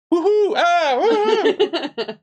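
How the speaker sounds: very high cartoon whoops; acrobatic fight noises. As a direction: whoop like a hero flipping about. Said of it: "Like this".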